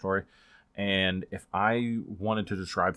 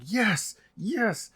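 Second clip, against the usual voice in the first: excited cheering; 13 semitones higher than usual. Retold or imitated. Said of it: "yelling and scr".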